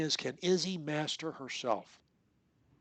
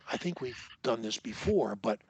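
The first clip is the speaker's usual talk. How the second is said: normal talking voice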